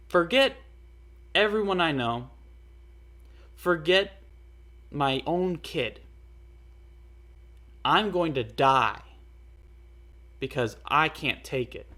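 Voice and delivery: loud, scornful mock of her words; mocking imitation. Mocking the girl's selfish goodbye, loud scornful imitation. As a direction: throw her words back with contempt.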